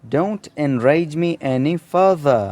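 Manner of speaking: slow and clear, words spaced; slow example sentence. Shown as a demonstration. Teacher reading the example slowly for students. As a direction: model sentence at dictation speed. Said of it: "मुझे मज़ीद गुस्सा नहीं दिलाओ".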